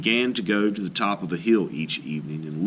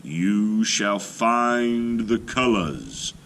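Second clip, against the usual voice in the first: slow, louder spirit voice; calling voice; 4 dB louder, slower than usual. Acted. Night voice tells faithful Little Gopher he will find the colors, slow and louder. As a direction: call out slowly, like a voice from a dream. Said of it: "he heard a voice calling to him".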